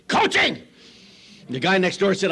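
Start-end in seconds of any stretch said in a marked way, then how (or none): 0.1-0.6 "coaching" as a high-pitched bark; hammed-up high yell; mock yelling. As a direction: punch it out like a screaming coach. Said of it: "screaming and yelling and cursing".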